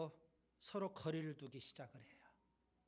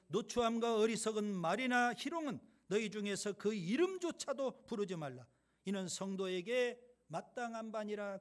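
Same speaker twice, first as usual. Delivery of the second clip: louder, measured Bible reading; scripture reading; 3 semitones higher, 6 dB louder than usual. Acted. Pastor reading scripture aloud to the church, loud and formal. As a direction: proclaim the verse to the congregation.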